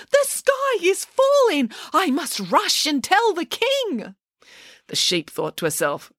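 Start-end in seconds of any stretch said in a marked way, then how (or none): 0.1-4.1 very high, slow wail of alarm; high-pitched, drawn-out storybook panic; panicked cry. A narrator's reading. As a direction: cry out like a hysterical little chicken. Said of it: "cried Chicken Little".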